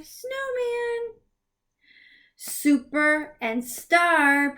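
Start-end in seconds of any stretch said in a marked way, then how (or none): 0.0-1.1 snowman high and bright; excited reveal. Shown as a demonstration. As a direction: reveal the word happily. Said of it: "put it together".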